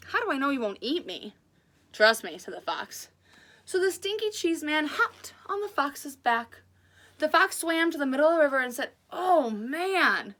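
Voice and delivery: lively read-aloud storytelling voice; animated children's story reading; story narration. A narrator's reading. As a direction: read it like a picture book to kids.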